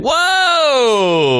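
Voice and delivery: shocked whoa. Real, not acted. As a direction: react in big surprise. Shocked at dad smoking weed.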